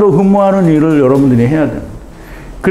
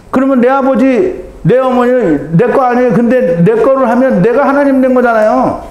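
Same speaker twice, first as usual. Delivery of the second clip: higher, pressing sermon voice; fervent preaching; 6 semitones higher than usual. Real, not acted. Preacher challenging the congregation about family, fervent preaching. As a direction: drive the point home.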